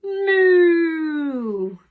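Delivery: loud, long mooing like a cow. Shown as a demonstration. Teacher showing kids a cow's sound, loud moo. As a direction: moo big for the children. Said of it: "what sound a cow makes".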